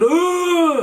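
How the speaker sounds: loud, high mock scream; imitated shout. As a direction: imitate a sudden wordless yell. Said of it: "She's shouting, shouting, shouting".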